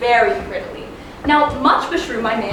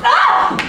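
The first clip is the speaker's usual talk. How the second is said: high, sharp breathless cry; 4 dB louder than usual. Acted. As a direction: cry out as he runs off. Helena cries out, out of breath.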